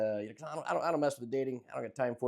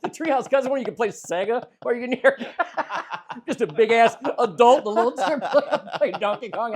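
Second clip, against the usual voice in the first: laughing; 7 semitones higher, 8 dB louder than usual. Real, not acted. Laughing through his own joke.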